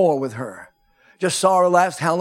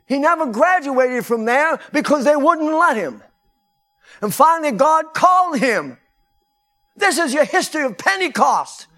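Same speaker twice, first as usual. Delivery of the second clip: preaching at full force; 10 semitones higher, 3 dB louder than usual. Real, not acted. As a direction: proclaim it to the rafters.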